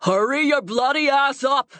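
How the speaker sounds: high-pitched gruff shout; gruff bellow. A narrator's reading. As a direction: bellow an impatient order from a distance. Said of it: "a gruff voice bellows".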